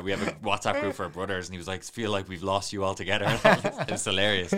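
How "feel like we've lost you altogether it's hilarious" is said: amused retelling, higher and louder, breaking into laughter; laughing. Real, not acted. Telling the host about his brothers' teasing, laughing. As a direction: laugh through the words, loud.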